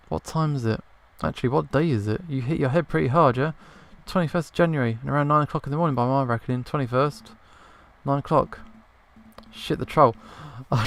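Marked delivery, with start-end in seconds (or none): none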